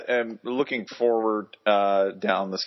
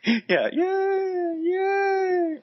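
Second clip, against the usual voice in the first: mock cheering; much slower than usual. Mock cheering the track laps.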